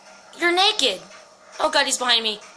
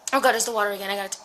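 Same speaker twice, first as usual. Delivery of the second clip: ordinary talk, nothing marked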